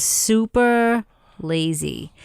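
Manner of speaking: slack-jawed, drawn-out Long Island accent; lazy Long Island. Shown as a demonstration. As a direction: let the Long Island vowels go lazy and slow. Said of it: "key to doing the best Long Island accent".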